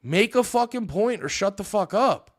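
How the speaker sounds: mock-angry challenge; exasperated demand. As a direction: snap back at a troll. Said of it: "You're like".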